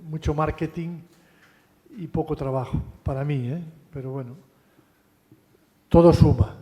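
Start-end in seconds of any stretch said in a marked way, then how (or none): 5.9-6.6 todo suma said much louder, punched on todo; emphatic. Real, not acted. Lecturer to students, conceding a point with loud emphasis. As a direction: hammer it home with force on todo.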